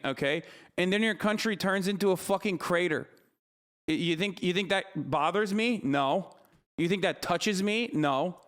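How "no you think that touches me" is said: ordinary talk, nothing marked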